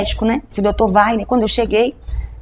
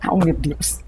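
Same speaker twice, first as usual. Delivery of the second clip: softer, lower, voice catching with real tears; choked up; 6 semitones lower, 6 dB quieter, much slower than usual. Real, not acted. Pastor retelling God's words to the host, choked up with tears. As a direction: overcome while telling the story. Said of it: "Pode chorar".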